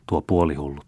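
ordinary delivery, nothing marked

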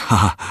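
short embarrassed "haha" laugh; a nervous giggle to cover his stumbling. A narrator's reading. Clerk laughs awkwardly to his employer, nervous laugh.

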